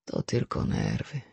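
dropped low and quiet; hushed voice. A narrator's reading. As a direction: say it low so only she hears. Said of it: "rzekł ściszonym głosem".

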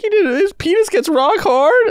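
put-on high, slow childlike wonder; confused little kid. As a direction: ask it like a baffled small child. Little kid confused by mom's book.

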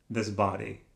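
ordinary delivery

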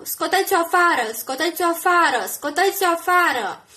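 loud rhythmic chant; crowd shouting. A narrator's reading. People shout at the gate under camera lights, chanting. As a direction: hammer it like a mob. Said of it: "oamenii strigau".

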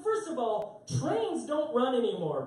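ordinary delivery

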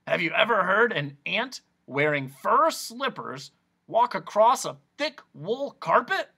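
croaking voice. A narrator's reading. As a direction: croak the question out eagerly. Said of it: "his strange, croaking way".

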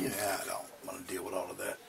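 ordinary talk, nothing marked

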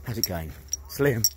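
ordinary talk, nothing marked

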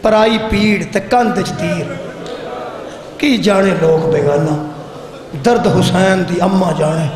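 slow, measured verse recitation that swells at the end; slow devotional poem rising on the last line; recited couplet. Acted. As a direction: draw out each line of the Sufi verse like a chant. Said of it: "ਪੀਰ ਮਹਿਬੂਬ ਅਲੀ ਸ਼ਾਮਦਾਨੀ ਫਰਮਾਉਂਦੇ ਸਨ".

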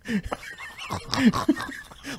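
airy, high wheeze of laughter; breathless laugh. Real, not acted. Host cracking up at the licking image, airless laugh. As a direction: laugh silently, gasping.

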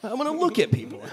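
high-pitched, laughing delivery. Real, not acted. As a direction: joke it through a real laugh. Joking reply amid shared laughter.

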